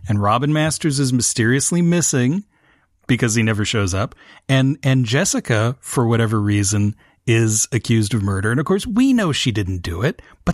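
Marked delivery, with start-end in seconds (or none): none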